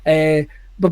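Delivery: ordinary talk, nothing marked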